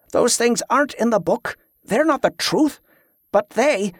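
high, insistent plea; almost desperate. A narrator's reading. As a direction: press urgently, pitch lifted. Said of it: "sounding almost desperate".

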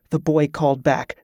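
plain reading voice

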